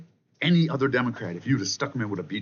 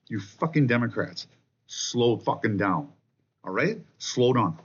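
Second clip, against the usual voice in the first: slow, drawn-out scold; scolding slowdown; slower than usual. Real, not acted. Ranting vlogger tells Democrats to slow down, slowed scold. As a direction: stretch each word out to lecture them.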